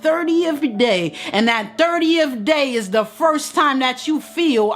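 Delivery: high, ringing preacher's cry; preaching at full force. Acted. Preacher urging Queen to claim her worth, full force. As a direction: preach it up to the rafters.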